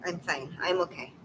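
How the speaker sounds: trying to steady the voice; tearful. Real, not acted. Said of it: "I don't know why I'm crying".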